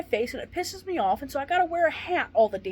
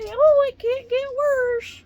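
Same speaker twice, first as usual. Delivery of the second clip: slow, higher, louder sing-song mockery; mock jinx; 13 semitones higher, 8 dB louder, much slower than usual. Mocking what she won't say aloud, slow and higher. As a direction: drag it out like tempting fate. Mock-quoting a jinxing phrase.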